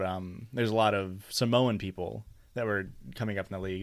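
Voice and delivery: ordinary talk, nothing marked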